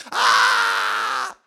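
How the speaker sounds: loud, low cry of agony; pain scream. Retold or imitated. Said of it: "Te dije que tenía que gritar". (grito de dolor desgarrado)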